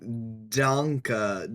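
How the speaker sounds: normal talking voice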